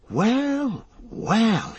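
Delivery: high, swooping note of relief; relieved exclamation. A narrator's reading. Hobbit spotting the way ahead, relieved high exclamation. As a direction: let each word soar up and fall. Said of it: "gave a whistle of relief".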